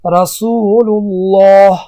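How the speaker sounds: "رسول الله" drawn out very slowly, the lam of الله full and heavy; slow Quranic demo with a heavy lam on الله; heavy lam in Allah. Shown as a demonstration. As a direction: recite it slowly as a tajweed model, thick lam in الله. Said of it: "مثال".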